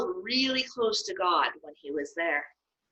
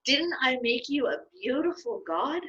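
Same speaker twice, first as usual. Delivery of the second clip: mild pride; 6 semitones higher than usual. Acted. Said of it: "said Aaron proudly".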